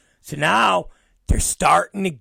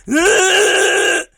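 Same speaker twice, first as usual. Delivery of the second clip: loud, very high sustained screech; piercing mimicked toddler scream; imitated kid's scream; 10 dB louder than usual. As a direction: shriek like a child mid-tantrum.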